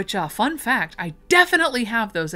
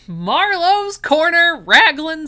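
voice cracking; 9 semitones higher, 8 dB louder, slower than usual. Real, not acted. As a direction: voice cracks up high. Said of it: "I don't know why my voice just cracked there".

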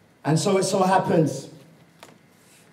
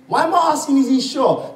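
ordinary talk, nothing marked

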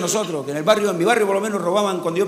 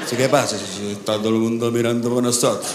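low-voiced mates arguing; 9 semitones lower than usual. The friends bicker on beach.

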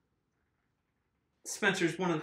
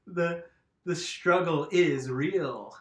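slow emphatic; 4 dB louder, much slower than usual. Real, not acted. Struggling with tire, slowed phrase.

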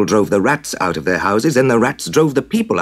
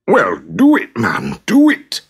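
urging; 3 semitones higher, 5 dB louder, slower than usual. Acted. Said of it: "urged the mayor".

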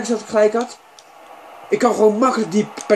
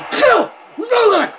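high, explosive yelled outburst; very loud shouting; 12 semitones higher, 4 dB louder, slower than usual. Real, not acted. Gamer calls for a yellow card, high shouting. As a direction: yell it high and hard. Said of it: "kijk, dat was zo hard".

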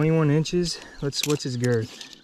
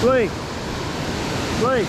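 calling out; 9 semitones higher than usual. Real, not acted. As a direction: call a friend from afar. Calling out to friend.

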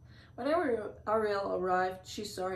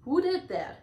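higher, louder sharp question; demanding; 9 semitones higher, 6 dB louder than usual. A narrator's reading. Crab conductor to musicians after a clang, demanding. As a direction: demand to know who did it. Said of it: "Sebastian demanded".

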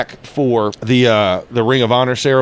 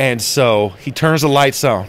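ordinary talk

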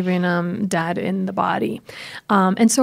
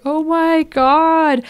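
exasperated; 6 semitones higher, much slower than usual. Retold or imitated. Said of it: "And you're just like".

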